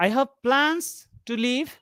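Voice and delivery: "plans" set apart as the key word; stress on PLANS. Shown as a demonstration. Teacher explaining the second meaning, stress on "plans". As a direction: put the weight on "plans", not on "leave". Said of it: "means I have some plans to leave".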